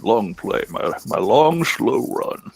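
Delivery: Sam Elliott impression. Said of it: "I'm fake Sam Elliott".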